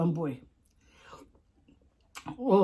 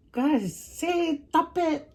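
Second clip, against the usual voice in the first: high playful; 9 semitones higher, much slower than usual. Pretend. Laughing playful scolding.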